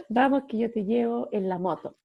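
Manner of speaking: high, laughing, eager invitation; Holy Spirit on a motorbike. Acted. The Holy Spirit inviting her onto the motorbike, high and laughing. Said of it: "Hace así".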